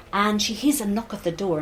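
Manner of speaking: ordinary delivery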